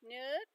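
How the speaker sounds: Nook said with the pitch gliding up; rising tone. Shown as a demonstration. Practising with the phone, Nook with a rising tone. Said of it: "'cause it's got the accent going up".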